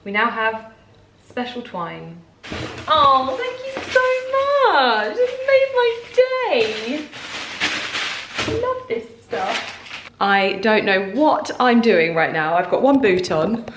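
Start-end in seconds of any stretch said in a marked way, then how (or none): 2.9-9.8 high, drawn-out, tearful squeal; overjoyed thanks. Real, not acted. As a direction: gush thanks, almost crying with joy. Overwhelmed thanks for gift.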